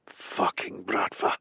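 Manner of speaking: slow, unvoiced whispered curse; muttered curse. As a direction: whisper the curse slowly under your breath. Captive curses the mob under breath.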